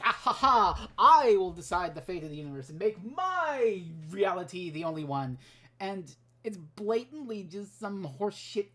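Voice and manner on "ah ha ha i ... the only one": hammed-up villain gloating, loud on the laugh; mock Rassilon. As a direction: declaim like a pompous cartoon tyrant. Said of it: "re-, Rassilon, trying to, do his stupid bullshit and be like".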